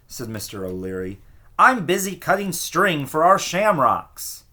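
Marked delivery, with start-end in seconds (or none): 1.6-4.4 impatient. A narrator's reading. Neighbour brushes him off.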